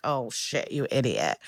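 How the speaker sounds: low, soft groan of dismay; quiet horrified mutter. Retold or imitated. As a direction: mutter it under your breath like you just saw disaster. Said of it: "Buchanan's like".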